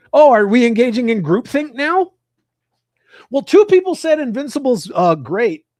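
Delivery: loud, high mocking indignation; mock the groupthink, loud and high; sarcastic outrage.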